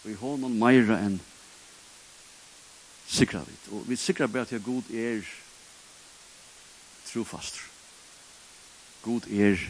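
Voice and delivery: usual speaking voice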